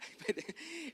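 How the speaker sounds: soft high laugh; brief high-pitched chuckle. Real, not acted. Pastor amused by his own story, soft laughing.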